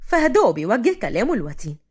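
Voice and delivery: ordinary delivery, nothing marked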